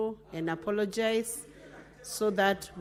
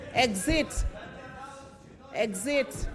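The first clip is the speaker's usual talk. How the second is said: shouted command; slower than usual. Real, not acted. Speaker expelling senator from chamber.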